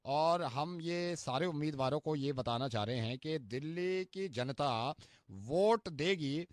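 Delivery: normal talking voice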